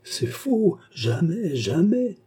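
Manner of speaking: high-pitched, vehement denial; aghast protest of innocence; strange outcry. A narrator's reading. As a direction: deny it in a high, swooping voice. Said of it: "s'écria-t-elle avec un accent étrange".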